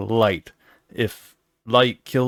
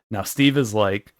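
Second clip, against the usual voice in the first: ordinary delivery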